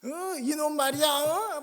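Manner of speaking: loud, high scolding bark; angry scolding. Retold or imitated. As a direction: curse him out from outside the gate. Angry mother keeps cursing.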